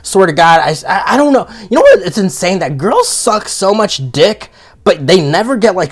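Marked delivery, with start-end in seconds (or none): none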